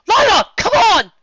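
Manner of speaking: high, loud urgent yell; loud commanding shout. A narrator's reading. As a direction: yell at them to run.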